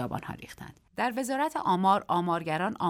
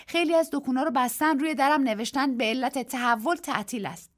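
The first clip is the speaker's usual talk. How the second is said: put-on higher, lively young woman; Daisy's voice; 7 semitones higher, 4 dB louder than usual. A narrator's reading. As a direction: voice Daisy, bright and eager. Daisy voiced, brings food. (صدای دیزی، بالاتر و پرشور)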